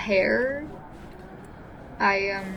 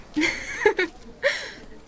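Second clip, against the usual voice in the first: bright laugh, pitched up; amused high laugh; 9 semitones higher than usual. Real, not acted. Sister laughing at Micah's threat to scream.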